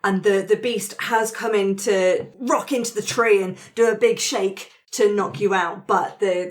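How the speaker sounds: ordinary talk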